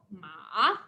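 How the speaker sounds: "Ma" dipping low, then rising. Shown as a demonstration. Teacher naming the horse in Chinese, dip-and-rise tone. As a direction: scoop down and up on "Ma". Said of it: "if you change the tone".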